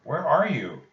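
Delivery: loud, high question; calling out. Acted. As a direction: call out into the dark. Jack calls into the forest.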